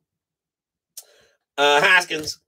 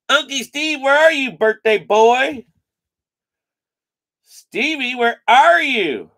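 calling out; 5 semitones higher, slower than usual. Real, not acted. Calling for the absent birthday boy.

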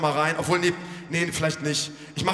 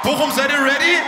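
high loud rally cry; crowd hype shout; 6 semitones higher, 7 dB louder than usual. Real, not acted. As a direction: fire up the crowd. Rallies crowd before song. (lauter Anheizer-Ruf ans Publikum)

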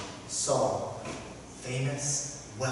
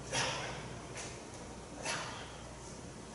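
intimate unvoiced whisper; 18 dB quieter, much slower than usual. God picking out David as he walks in, whispered. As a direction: breathe the words like a secret choice.

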